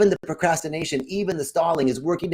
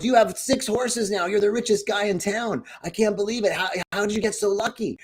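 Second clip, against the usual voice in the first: excited neighbor; 6 semitones higher than usual. Retold or imitated. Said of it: "the neighbor comes by and goes".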